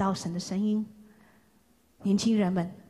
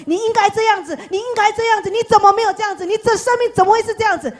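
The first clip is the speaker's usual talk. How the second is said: much higher and louder nagging; loud harsh voice; 11 semitones higher, 7 dB louder, faster than usual. Acted. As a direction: bark the demands like a pushy critic. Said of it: "很大声的声音告诉你". (大声严厉地指责)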